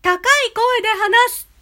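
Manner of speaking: high bright-pitched demo. Shown as a demonstration. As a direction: lift the voice way up. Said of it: "高低は".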